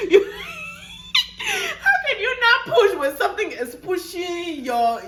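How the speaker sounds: high, loud laughing exclamation; laughing disbelief. Real, not acted. Mother telling viewers laughing about the urge to push.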